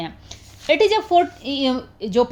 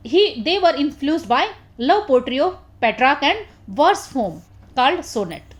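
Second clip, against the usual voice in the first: slow dictation; much slower than usual. Acted. Dictating notes to the class.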